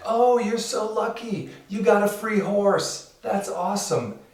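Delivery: high-pitched admiring villagers; gushing villagers. Acted. Villagers congratulate the man on his horse, high and gushing. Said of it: "the villagers are all like".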